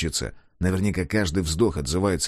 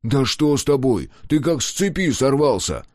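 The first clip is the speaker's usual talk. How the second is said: higher, louder protest; a touch of shocked reproach; upset shout; 6 semitones higher, 4 dB louder than usual. A narrator's reading. Klaus demands to know what has gotten into Scar.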